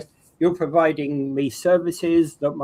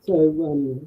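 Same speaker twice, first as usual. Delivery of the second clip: underwater. Real, not acted. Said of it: "underwater voice".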